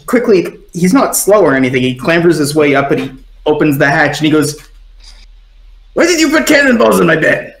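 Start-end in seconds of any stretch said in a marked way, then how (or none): 5.9-7.6 high, rapid character outburst; pissed off. Acted. Crewmate opening the hatch on Sun, pissed off. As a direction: fire off the accusation fast and high. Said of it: "pissed off enough to not wanna bother".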